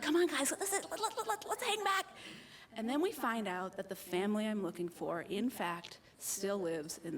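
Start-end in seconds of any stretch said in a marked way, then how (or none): 0.0-2.1 stammering, rising much higher in mock panic; trying to hold the friends back, tripping over the words; nervous stammer. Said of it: "And I'm like".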